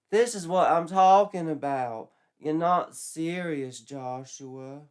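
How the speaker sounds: put-on high, slow girlish voice; girlish Betsy. Betsy turning Joshua down, high and slow. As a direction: sigh him off like a teen girl.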